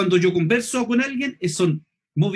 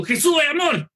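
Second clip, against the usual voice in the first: high, harsh shout at odds with the loving words; mock-aggressive preacher's yell; contradicting shout; 6 semitones higher, 3 dB louder than usual. Shown as a demonstration. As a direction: shout it as if scolding. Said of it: "el efecto todo contrario".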